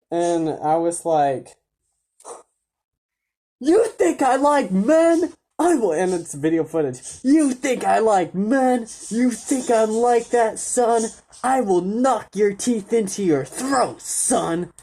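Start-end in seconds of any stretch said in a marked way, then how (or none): 3.6-5.9 hammed-up outraged father, stretching the words; irate dad yelling. Imitating a dad answering his son's insult, furious high yell. 7.2-14.7 high-pitched mock-furious threat; irate dad yelling. Imitating a dad threatening his son after an insult, high angry yell.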